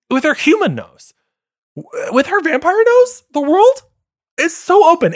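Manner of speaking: rising mock-awe; excited gush. Real, not acted. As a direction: marvel at the wonder of it. Gushing about vampire senses.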